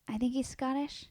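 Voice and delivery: weak imitation of a Scottish accent; mock Scottish accent. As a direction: attempt a Scottish accent and fall short. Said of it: "I can't do a Scottish accent".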